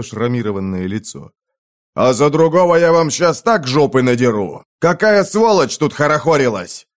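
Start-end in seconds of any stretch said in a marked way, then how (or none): 1.9-6.9 gruff furious threat; angry soldier. Acted. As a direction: bellow at them. Вояка бранится. (злобно)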